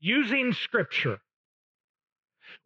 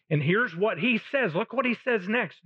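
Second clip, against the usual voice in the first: ordinary delivery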